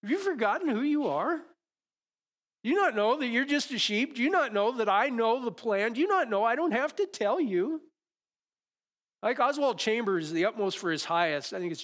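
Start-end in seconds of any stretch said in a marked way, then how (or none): 0.0-7.8 high, loud, incredulous scolding questions; exasperated God. Acted. God scolding the wandering sheep, high loud disbelief. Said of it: "God goes".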